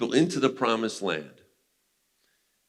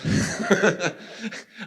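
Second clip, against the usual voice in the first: high burst of laughter; 11 semitones higher than usual. Retold or imitated. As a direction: laugh high as the delighted governor. Governor's laugh in retold story.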